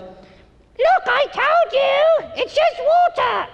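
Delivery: put-on very high little-girl copy of the scolding; six-year-old girl mimicking. Young daughter in the Space Mountain line, mimicking the dad very high. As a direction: squeak it out like a tiny girl. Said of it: "my younger daughter ... goes".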